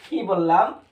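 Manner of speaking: ordinary talk, nothing marked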